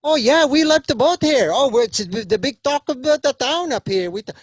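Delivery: put-on high, loud chirpy old father; cheerful dead dad. Dead father brags to son about voting, high and loud. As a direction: boast happily about the afterlife.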